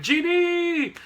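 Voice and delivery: very high, drawn-out cheer of a name; mock excited greeting. X-Men greeting Jean back at the mansion, high mock cheer. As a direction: squeal the name like a welcoming crowd.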